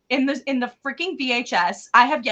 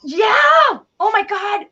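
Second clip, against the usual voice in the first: excited; 8 semitones higher, 6 dB louder than usual. Real, not acted. Recalling the feet detail.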